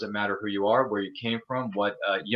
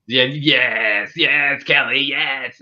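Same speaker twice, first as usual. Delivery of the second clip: hammed-up louder evil emperor hiss; Palpatine voice; 7 dB louder, much slower than usual. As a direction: ham up a sinister Sith lord yes. Echoing Kelly's emperor-like "yes.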